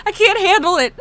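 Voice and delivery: loud, high, tearful outburst; crying laughing. Real, not acted. Streamer overwhelmed by crying toggles, crying laughing. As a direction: burst out high and loud, near tears with laughter. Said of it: "I'm crying laughing right now".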